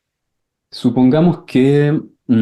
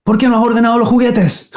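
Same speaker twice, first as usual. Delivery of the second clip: much higher, sharp scolding mother; scolding mom; 10 semitones higher than usual. Acted. Mother scolds the child about the toys, higher and sharp. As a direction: play the upset mom demanding why. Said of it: "tu mamá te dice".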